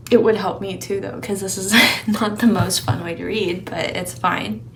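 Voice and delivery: ordinary talk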